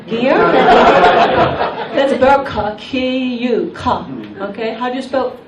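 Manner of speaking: ordinary talk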